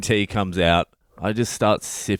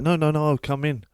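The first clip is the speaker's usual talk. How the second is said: lightly voiced old woman, higher and quick; insistent old lady; 7 semitones higher, faster than usual. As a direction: imitate her pushing in, high and fast.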